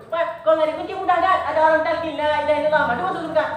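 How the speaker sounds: high, loud urgent coaxing; mock urgent talqin. Preacher acting a deathbed talqin, urgent and loud. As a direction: hurry the dying man through the words.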